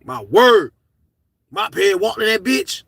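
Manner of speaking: ordinary talk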